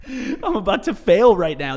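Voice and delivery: breaking into laughter mid-confession; laughing through it. Retold or imitated. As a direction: laugh at your own despair while saying it. Laughing while retelling breakdown.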